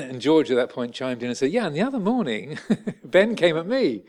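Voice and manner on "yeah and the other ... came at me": higher, louder and chuckling through the words; laughing. Retold or imitated. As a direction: tell it while laughing at the memory. George joking, laughing.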